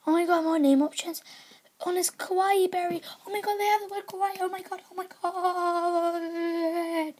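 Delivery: high excited squeal; overjoyed fan squealing; fangirling. Real, not acted. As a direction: gush over it breathlessly. Fangirling over name options.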